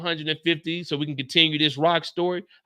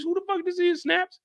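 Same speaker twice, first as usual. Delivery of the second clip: incredulous; 10 semitones higher than usual. Retold or imitated. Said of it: "And I'm like".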